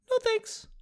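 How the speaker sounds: tiny high-pitched mock voice; comic falsetto brush-off; mock polite refusal. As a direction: squeak out a refusal. Retold refusal of commission salesmen.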